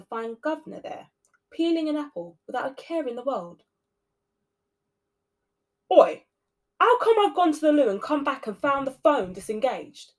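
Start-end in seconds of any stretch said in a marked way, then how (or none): 5.9-10.1 loud gruff telling-off; growling. A narrator's reading. Night governor scolding the cleaner, gruff and loud. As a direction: bark at her. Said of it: "he growled at her".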